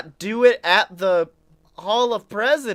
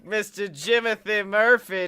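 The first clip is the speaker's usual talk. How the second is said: big, raised ringmaster opening that eases into the introductions; show announcer; 4 semitones higher, 5 dB louder than usual. Acted. Host welcoming the audience and introducing the co-host, announcer voice. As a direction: kick off the show loud and high.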